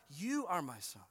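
"you" stressed, "are my son" dropping away softly; single out "you", let the rest fall. Shown as a demonstration. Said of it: "our tone".

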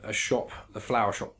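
ordinary delivery

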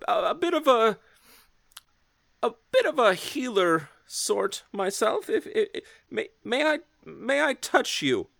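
high, stammering shy healer; man doing a woman's voice. Acted. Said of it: "he walks up to you and he says".

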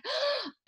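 sharp high gasp of shock; retold gasp. Cashier hearing she met a medium, gasping sharply. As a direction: mimic the cashier's startled intake of breath. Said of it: "And she's like".

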